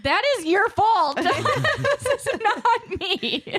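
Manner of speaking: lightly voiced husband, slowing on not me; blaming husband. Husband blaming wife for the kid's swearing, laughing mock accusation. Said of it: "Ben looks at me like".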